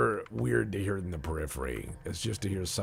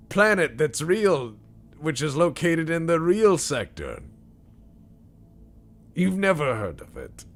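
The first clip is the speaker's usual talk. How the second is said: high, loud hammed-up announcer voice; put-on old broadcaster proclamation; company spokesman; 10 semitones higher, 8 dB louder than usual. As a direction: insist like a shady corporate pitchman. Spokesman answers about the office.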